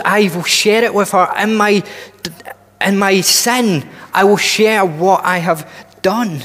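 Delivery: ordinary talk, nothing marked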